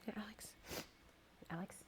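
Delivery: soft, choked request; crying, calling for help; tearful. Real, not acted. Crying, asking for tissue.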